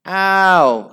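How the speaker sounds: high, loud ow; mock squeal. Vain Violet hurt in class, squealing ow. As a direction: yelp it out. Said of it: "squealed Vain Violet".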